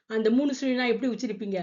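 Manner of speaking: ordinary delivery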